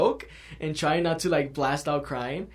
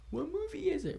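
put-on much higher childlike voice; high kid voice asking innocently; playing himself as a kid; 6 dB quieter than usual. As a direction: squeak it like a curious little kid. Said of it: "I'm playing myself as a kid".

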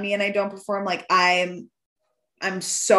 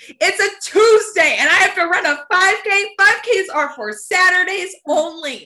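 higher, loud mock-furious rant; louder, higher indignant mock shout; retold outraged rant; 10 semitones higher, 6 dB louder than usual. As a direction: rant it like the run ruined the week.

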